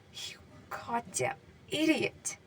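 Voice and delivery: slow, soft, breaking whisper. Acted. Katsuki over Deku's body, voice breaking. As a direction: let the voice crack on it. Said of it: "voice breaking".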